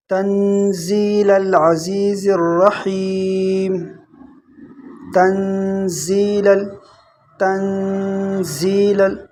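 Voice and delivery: slow chanted recitation; melodic recitation. Shown as a demonstration. Teacher chants the verse slowly. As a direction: chant it with drawn-out vowels.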